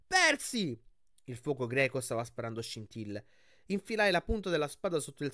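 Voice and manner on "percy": high, loud call of his name; loud warning call; urgent call. Acted. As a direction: shout to warn him. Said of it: "Annabeth gridò".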